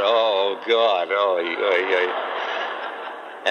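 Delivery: laughing, half-groaning oy oy oy; a rueful laugh through the oys. Retold or imitated. Exasperated reaction to his friend.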